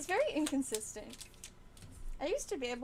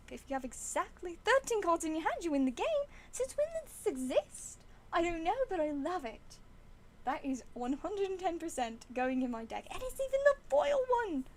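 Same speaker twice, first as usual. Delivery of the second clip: higher, louder burst of delight in a put-on accent; excited disbelief; 5 semitones higher, 4 dB louder than usual. Real, not acted. Streamer discovers card that wins the game, excited disbelief. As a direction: get carried away with amazed glee. Thrilled by a surprise card find.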